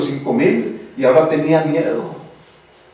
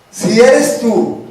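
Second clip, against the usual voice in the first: slow doubtful Peter; 6 semitones higher, 4 dB louder, much slower than usual. Acted.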